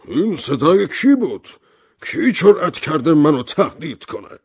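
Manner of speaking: high, loud indignant outburst; agitated. A narrator's reading. General challenges whoever threatened him, agitated and indignant. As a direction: demand answers, pitch leaping and loud.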